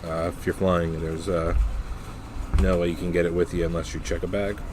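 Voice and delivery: ordinary talk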